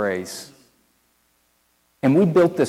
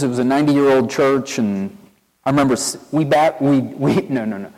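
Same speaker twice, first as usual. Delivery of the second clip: ordinary talk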